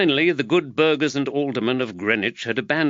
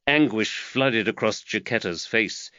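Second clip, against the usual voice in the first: usual speaking voice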